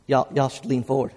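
low, quiet table talk; softly voiced retold murmur; hushed aside. Retold man at crowded table.

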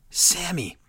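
menacing whisper of the name; whispered Sami. Retold or imitated. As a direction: breathe the name like a threat. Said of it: "He says".

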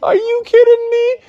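very high, incredulous mocking voice; scornful frog. Froggy scoffing at the kittens, high and mocking. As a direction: scoff at the baby cats.

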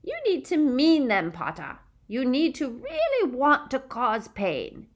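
a gleeful sadistic witch taunting; cruel witch. A narrator's reading. Bellatrix taunting Harry.

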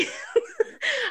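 burst of laughter. Real, not acted. Recalling the pillowcase she stole, real laughter. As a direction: crack up at the memory.

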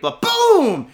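high, loud boom; mock gunshot. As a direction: blurt the gunshot. Gun going off in retold story.

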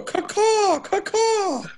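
very high squawking parrot call; parrot caw. Retold or imitated. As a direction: screech it like a parrot. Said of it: "Just go".

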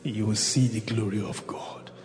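slow unison scripture reading; reading together. Real, not acted. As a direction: read it aloud all as one. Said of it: "together, one, two, go".

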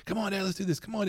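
mimicking eager kid. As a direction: hurry dad along like the kid. Kid urging dad, mimicked.

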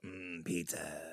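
low, soft gremlin croon over food. Retold or imitated. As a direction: growl it low like a hungry gremlin. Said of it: "crowd around the pizza and just go".

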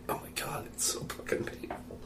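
hushed lion in pain; soft, voiceless acted complaint; pained whisper. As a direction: whisper it as if hurting. Joke: lion with thorn in paw.

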